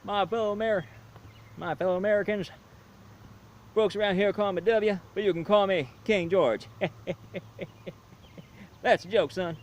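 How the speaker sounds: a joking cowboy president addressing the nation; George W. Bush impression. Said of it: "Oh yeah, George W".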